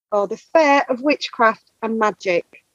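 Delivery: normal talking voice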